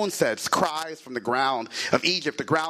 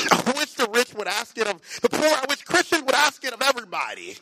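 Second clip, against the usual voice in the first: high, urgent pulpit appeal; impassioned preaching; 10 semitones higher, faster than usual. Real, not acted. Preacher pleading for America to ask forgiveness, impassioned preaching. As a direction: preach it fervently, pitch raised and driving.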